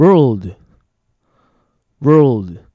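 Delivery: very loud, isolated "world" with clear l; pronunciation of WORLD. Shown as a demonstration. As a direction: punch out "world" as a listening quiz. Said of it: "世界の方でした".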